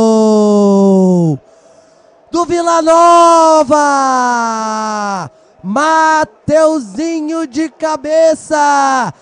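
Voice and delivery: long held goal scream; explosive goal commentary. Real, not acted. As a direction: belt out the goal, stretch it.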